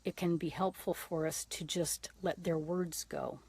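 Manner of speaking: normal talking voice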